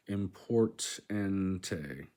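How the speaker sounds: "Importante" in drawn-out separate syllables; very slow, break up "Importante"; slowly sounded out. Shown as a demonstration. Learner sounding out a new word, "Importante" very slowly.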